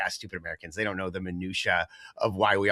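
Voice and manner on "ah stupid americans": muttered dismissively, much softer; a low, sneering aside; scoffing mutter. Retold or imitated. British fans scoffing at Americans, muttered softly. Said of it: "they're just like".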